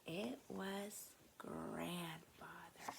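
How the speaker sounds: slow, hushed whisper. A narrator's reading. Teacher whispering the reveal to the children. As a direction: breathe it out slowly. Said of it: "whispered story line".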